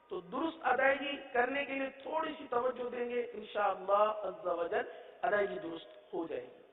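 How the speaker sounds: ordinary delivery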